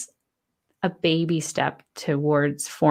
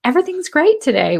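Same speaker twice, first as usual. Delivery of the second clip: mock cheery; 10 semitones higher, 4 dB louder, faster than usual. Mock forced cheer quote.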